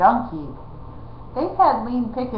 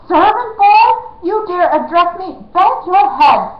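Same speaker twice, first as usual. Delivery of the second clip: much higher, loud outraged snap; outraged snapping; 10 semitones higher, 8 dB louder than usual. A narrator's reading. Outlaw snaps at the pretend servant, outraged and loud. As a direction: bark out the insult in outrage. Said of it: "snapped Hold Your Nose Billy".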